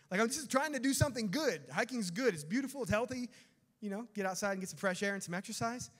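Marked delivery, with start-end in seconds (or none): none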